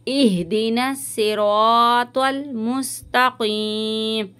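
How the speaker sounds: very slow recitation with a thick q in मुस्तकीम; tajweed recitation. Shown as a demonstration. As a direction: chant इहदिना सिरातल मुस्तकीम slowly. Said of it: "काफ को मोटा करके पढ़ेंगे". (धीमी तजवीद तिलावत)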